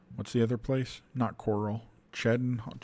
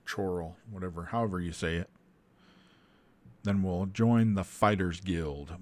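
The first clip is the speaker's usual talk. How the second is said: ordinary delivery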